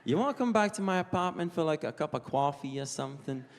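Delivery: New York pick-up line; New York accent. Acted. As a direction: invite them home like a New Yorker. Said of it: "like a New York accent".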